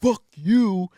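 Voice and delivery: high, loud retold yell; shouted curse. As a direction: spit it at him as she drives off. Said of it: "She's like".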